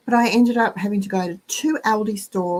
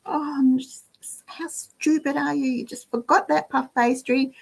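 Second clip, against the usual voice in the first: higher, mock-exasperated telling-off of herself; self-scolding; 6 semitones higher than usual. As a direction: scold yourself for forgetting, pitched up. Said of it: "I was like".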